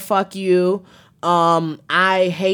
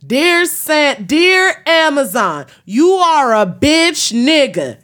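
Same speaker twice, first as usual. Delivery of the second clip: louder, higher, slow mock address; Riley-style yelled letter; 8 semitones higher, 5 dB louder, slower than usual. Quoting Riley's cartoon rant at Amazon, louder and higher. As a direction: belt out a fake letter to Amazon with a grand fury. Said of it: "I feel like Riley in the episode is just kinda like".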